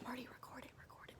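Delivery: whispered plea to her husband; urgent whisper. Retold or imitated. Mother whispering to Marty to film the birth. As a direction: hiss it urgently under your breath. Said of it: "and I was like".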